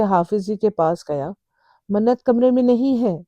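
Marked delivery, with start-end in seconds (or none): 1.9-3.2 higher, louder panicked cry. A narrator's reading. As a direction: blurt it out in alarm. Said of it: "Talha نے گھبرا کر کہا". (گھبراہٹ میں اونچی آواز)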